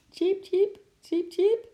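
very high little chirps; string of high-pitched cheeps; squirrel peeping. A narrator's reading. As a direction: squeak like a small squirrel. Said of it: "piepte de kleine eekhoorn".

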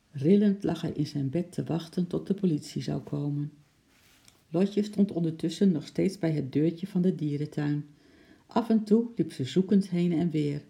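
plain reading voice

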